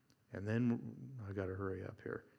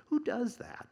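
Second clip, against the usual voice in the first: incredulous; 12 semitones higher than usual. Real, not acted. Preacher mocking the disciples, incredulous and high. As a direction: ask it in comic disbelief.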